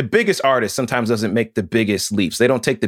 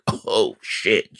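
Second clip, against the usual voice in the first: low, hushed amazement; awed reaction; 4 semitones lower, 3 dB quieter than usual. Retold or imitated. Host recalling first hearing the doctor line, hushed awe. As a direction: drop low as if quietly floored.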